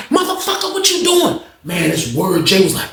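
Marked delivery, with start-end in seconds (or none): 0.1-1.4 high, shocked outcry; boy yelling at the man who yanked the shower open; startled yell. Retold or imitated. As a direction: cry out as you're caught off guard.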